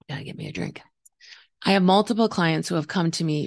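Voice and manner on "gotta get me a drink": whisper. Real, not acted. Aside whispered before drinking.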